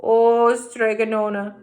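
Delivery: loud, slow moaning complaint; lovesick sigh. Acted. Big Anthony moans to the witch about spring fever. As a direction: moan it out like a lovesick lad. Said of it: "said Big Anthony".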